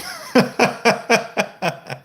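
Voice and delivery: cracks up at the question; a sudden high burst of laughter; genuinely amused laugh; real laugh. Real, not acted. Reacts to a joke question.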